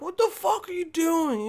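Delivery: high squawk of a shocked stranger at the market; shocked onlooker. Retold or imitated. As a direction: play a startled shopper yelling. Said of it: "people are like".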